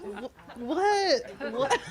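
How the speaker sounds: high, shocked double take through laughter; laughing disbelief; stunned what. Retold or imitated. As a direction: blurt it out in disbelief. Stunned at five joints.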